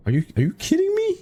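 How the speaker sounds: high, disbelieving cry; shocked squeal. Real, not acted. Lost only iron pickaxe in lava.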